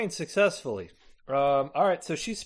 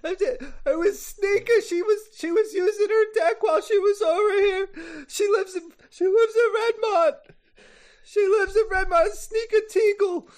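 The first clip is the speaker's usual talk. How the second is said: high-pitched tearful screaming; screamed confession; 13 semitones higher, 3 dB louder than usual. Acted. Mother giving up the girlfriend to save her son, screaming. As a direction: scream the facts out in sobbing panic. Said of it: "she starts screaming".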